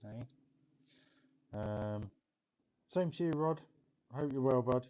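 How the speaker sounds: ordinary delivery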